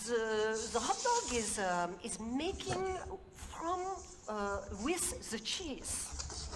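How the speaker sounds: put-on slow, halting, lightly French-accented; a French cook searching for the words, with a hint of French. French engineer trying again with Korean guy, slow light French accent. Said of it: "So the French engineer tries again".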